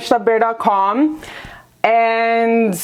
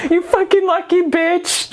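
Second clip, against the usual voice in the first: mock jealous jab; 7 semitones higher than usual. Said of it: "because I'm like".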